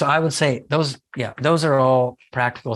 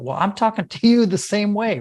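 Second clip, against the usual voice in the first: much higher, mock argumentative retort; matching kid's tone; 8 semitones higher than usual. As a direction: mimic someone arguing back in a child's tone. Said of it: "they match the tone of voice of their kids".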